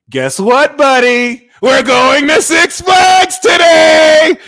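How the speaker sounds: very high, very loud, slow shouted announcement; excited dad. Retold or imitated. Dad telling his five-year-old about Six Flags, high loud shout. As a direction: shout the big surprise way up high. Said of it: "your dad tells you".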